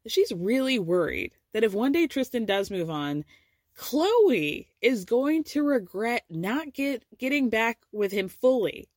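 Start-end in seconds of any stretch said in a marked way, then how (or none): none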